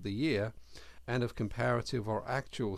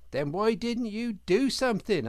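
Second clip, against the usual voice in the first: louder, higher indignant question; demanding outraged answers; 11 semitones higher, 7 dB louder than usual. A narrator's reading. Otter scolding the rabbit.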